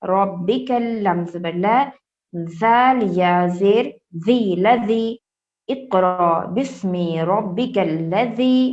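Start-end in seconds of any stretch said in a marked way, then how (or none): 5.7-8.6 slow recitation, لذی with the tongue between the teeth. Shown as a demonstration. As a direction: stretch it slowly, ذ with the tongue out. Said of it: "لذی میں ٹنگ کو باہر نکالیں گے". (لذی میں زبان باہر نکال کر)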